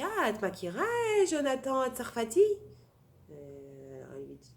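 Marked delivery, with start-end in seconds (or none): 0.0-2.4 high, loud, eager question; imitated eager curiosity. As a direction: play a chatty driver thrilled to find a connection. Imitates driver eagerly seeking a connection.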